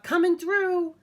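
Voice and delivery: self-important truck yelling to get by; bossy dump truck. Acted. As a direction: push everyone aside, full of yourself. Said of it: "yelled the dump truck".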